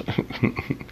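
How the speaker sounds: breathy nervous chuckle; a scared little laugh; nervous laughter. Real, not acted. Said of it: "I'm laughing out of fear".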